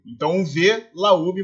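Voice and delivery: normal talking voice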